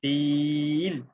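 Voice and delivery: فیل with the ee stretched very long; long ee in feel. Shown as a demonstration. Teacher demonstrating madd on a word, slow. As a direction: draw out the ee of فیل. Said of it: "فا کے نیچے زیر ہے".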